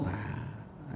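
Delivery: ordinary delivery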